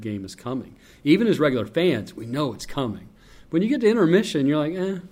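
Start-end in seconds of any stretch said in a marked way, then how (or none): 4.7-5.0 mild letdown. Acted. Voicing letdown at intermission.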